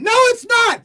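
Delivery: very high indignant snap; shrill mock outrage at the nurse; hammed-up high-pitched refusal; furious whine. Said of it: "Now I'm furious".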